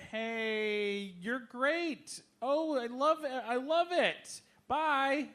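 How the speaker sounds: high, chirpy celebrity hello-and-bye; breezy brush-off. Retold or imitated. Star brushing off a fan, high and breezy. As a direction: gush then flee, all sparkle.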